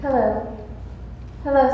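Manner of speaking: ordinary delivery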